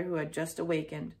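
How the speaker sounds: ordinary delivery, nothing marked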